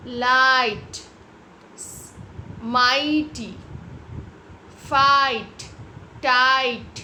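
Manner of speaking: लाइट, माइटी, फाइट, टाइट loud with stretched "aai"; long I sound. Shown as a demonstration. Teacher drilling the class, long loud लाइट, माइटी, फाइट, टाइट. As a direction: punch and hold each vowel in लाइट, माइटी, फाइट, टाइट. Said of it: "आई का sound is long".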